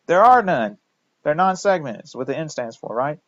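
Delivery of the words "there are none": "There are none" said louder and higher; emphatic answer. Shown as a demonstration. Lecturer answers her own quiz question, loud and emphatic. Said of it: "Name the segmented D N A viruses".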